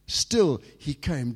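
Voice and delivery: ordinary talk, nothing marked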